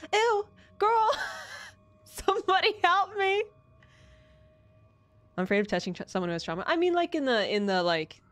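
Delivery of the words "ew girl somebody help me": high panicked whimpering; being chased. Real, not acted. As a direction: squeal for help. Leeches chasing, crying out.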